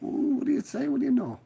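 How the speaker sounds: a playful falsetto jab; mock high voice. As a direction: toss it off in a silly high voice. Teasing caller in a sudden voice.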